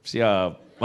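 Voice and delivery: low, compliant answer, acted out; rehearsed obedience. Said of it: "Saya latihan di kaca".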